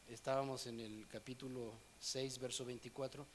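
usual speaking voice

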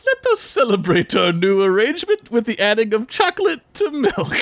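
high, goofy cartoon dad voice; Homer. Homer answering his begging kids, mock-grand proclamation. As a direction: proclaim it grandly as a comic impression. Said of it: "And he's like".

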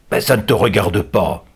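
curt captor cutting her off; curt rebuff. A narrator's reading. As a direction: shut the question down. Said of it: "tranche le gitan".

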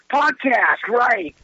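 sudden realization. Retold or imitated. Retells sudden remembering.

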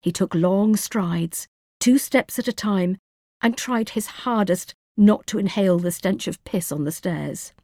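plain reading voice

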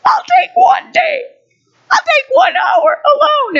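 high, loud tearful pleading; crying complaint. Retold or imitated. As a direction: bargain desperately through tears. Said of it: "I started crying to the staff".

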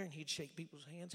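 ordinary delivery

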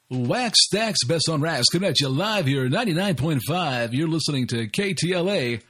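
hammed-up radio host announcing the station; radio jingle voice. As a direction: sell it like a cheesy radio jingle. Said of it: "like, a radio jingle voice in the morning".

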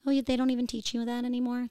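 high-pitched imitation of a puzzled reply; surprised stranger. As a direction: put on someone else's incredulous voice.

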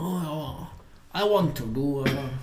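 bad Chinese accent imitation; clumsy mock Chinese accent; foreigner speaking mandarin. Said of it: "my Chinese imitation is really crappy".

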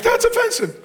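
very high, whiny-pitched objection; mimicking someone scandalized; mock offended. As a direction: squeal it like an offended listener.

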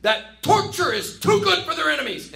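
high, loud hammed-up proclaiming; loud proclamation. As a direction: proclaim it loudly with mock outrage. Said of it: "who loudly proclaim".

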